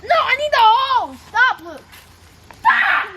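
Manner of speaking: loud protesting yell; shouting. Real, not acted. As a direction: yell at him to stop. Kids fighting over the hose.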